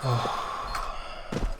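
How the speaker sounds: soft, drawn-out sigh; heavy sigh. Real, not acted. Streamer doubting the tactic, soft sigh. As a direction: breathe out slowly in frustration. Sighs while unconvinced.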